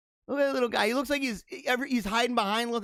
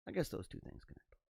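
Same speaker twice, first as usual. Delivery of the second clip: whispered quickly to himself; whispered aside; 3 semitones lower, 15 dB quieter, faster than usual. Shown as a demonstration. Repeating his own line to the viewers as a doubtful whisper. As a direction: mutter it under your breath as a doubtful aside.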